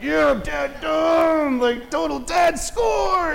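very high, slow gloating cheer; triumphant dad. Retold or imitated. Dad imitating his inner gloat at the parade, very high slow cheer. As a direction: crow over your victory. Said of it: "I just wanted to be like".

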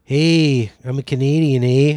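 put-on loud, cheery Canadian accent with a long eh; mock Canadian accent, drawn-out eh. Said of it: "that's a great, uh, Justin Trudeau accent".

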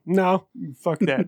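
ordinary talk, nothing marked

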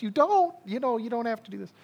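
put-on a boy silently begging his dad to stop; high squeaky child voice. Pastor voicing his child self pleading with his dad, high and fast. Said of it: "my thought is".

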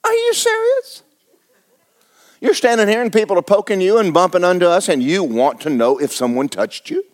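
a sudden high squeak of disbelief that settles back down; unbelieving, as if the question is absurd; incredulous disciples. Acted. Disciples asking Jesus if he is serious, incredulous. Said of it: "His disciples went".

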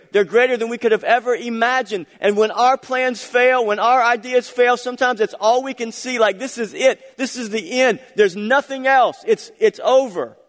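loud, high, impassioned preacher voice; fervent sermon peak; full-force preaching. Acted. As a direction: drive the climax home to the congregation. Climax of the sermon.

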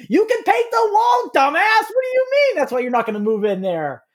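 high, incredulous mock-outraged shout; yelling at the TV. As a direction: shout it like an exasperated viewer heckling the screen. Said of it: "they're just yelling the same thing at the T V".

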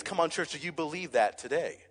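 ordinary talk, nothing marked

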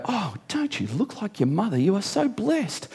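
high, soft gushing coo; gushing admirer. Retold or imitated. Admirer telling preacher's daughter she looks like mother, gushing. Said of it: "they see our, uh, one of our daughters and they go".

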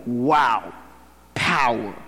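drawn-out, low-pitched awe; hushed awe. Retold or imitated. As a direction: let the amazement sink in, low and measured. Awestruck reaction to Samson's feat.

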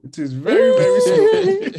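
very slow, rising high and loud; drawn out slowly. Shown as a demonstration. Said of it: "It's very slow".

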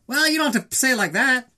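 mock him whining back at her; whiny defensive comeback. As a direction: Adonis whining back at Bianca, mocked high. Adonis quoted, whiny defensive comeback.

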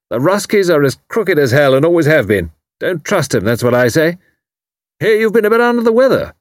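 loud, high hearty military man; retired commander teasing his neighbour, loud and hearty; bluff old commander. A narrator's reading. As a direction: boom it out like a blustering retired officer. Haydock voiced.

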